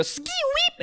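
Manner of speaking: high, squeaky DJ scratch sound; record scratch. Acted. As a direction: imitate a needle skidding off a record. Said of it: "have a D J scratch off the record".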